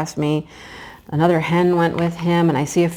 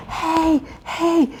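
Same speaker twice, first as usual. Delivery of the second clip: mock yell; 11 semitones higher than usual. Woman chasing a fox off her chicken, mock yell. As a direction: imitate yelling, pitched up, volume held back. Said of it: "I'm yelling".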